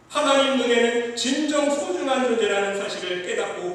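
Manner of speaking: loud, high preacher's voice pressing each phrase; fervent preaching. Acted. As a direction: preach it out with full force to the congregation. Preaching at full force.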